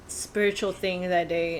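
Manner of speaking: usual speaking voice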